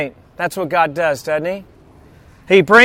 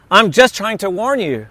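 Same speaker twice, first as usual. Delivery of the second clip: ordinary talk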